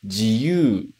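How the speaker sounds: "jiyu" as ji-yu, sounds separate; teacher models "jiyu" with ji and yu apart; uncontracted jiyuu. Shown as a demonstration. Teacher showing freedom word: "jiyu" split ji-yu. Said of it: "primeiro, a liberdade".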